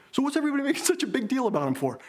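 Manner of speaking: tossing off the question like it's absurd; indignant retold. Dad indignant at Lendl fuss.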